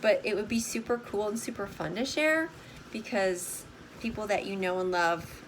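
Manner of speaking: normal talking voice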